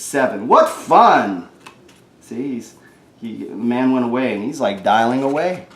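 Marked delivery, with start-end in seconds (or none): none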